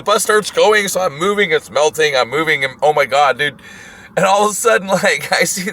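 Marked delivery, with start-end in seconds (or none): none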